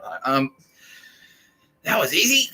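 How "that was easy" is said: higher, louder, hammed-up smug soldier; gloating invader. Greek soldier inside Troy, hammed-up gloating. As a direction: crow about it like a sneaky soldier.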